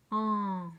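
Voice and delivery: firm, lengthened teaching "An"; isolated sound. Shown as a demonstration. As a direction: sound out "An" clearly and sustain it. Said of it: "c'est deux lettres".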